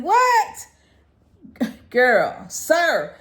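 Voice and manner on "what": much higher and louder what; incredulous. Real, not acted. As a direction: squeal it out in disbelief. Reacting to Tyson losing.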